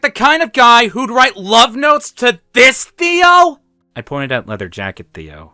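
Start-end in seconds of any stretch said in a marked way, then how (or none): 0.0-3.6 high, loud, slow outrage; incredulous, shouted rhetorical question; exasperated rant. A narrator's reading. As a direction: build to a shrieked last word. Exasperated rant at the wrong Theo.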